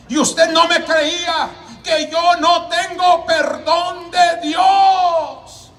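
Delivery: high, drawn-out cry of a condemned man; retold wail of hopelessness; despairing outburst.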